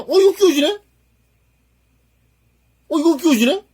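thrilled the piece actually fits; surprised delight. Real, not acted. Builder thrilled a kit part fits, high delighted squeal.

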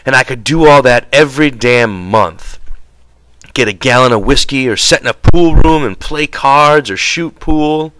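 worked-up angry griping; angry rant. A narrator's reading. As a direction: rant on about all you could have. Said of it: "looked over the fire at the angry George".

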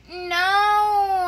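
high, loud, drawn-out wail. Real, not acted. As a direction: wail it out in despair at the game. Frustration after failing in game.

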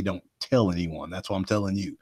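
ordinary delivery

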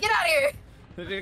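loud playful bellow for the killer; mock angry yell. Voicing the killer shooing the player off, mock yell. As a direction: burst out loud, acting the killer. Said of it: "he's like".

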